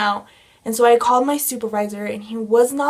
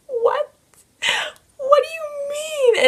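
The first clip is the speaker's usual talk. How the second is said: shocked disbelief. Retold or imitated. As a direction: voice jumps way higher in shock. Threatened with towing, breaking down.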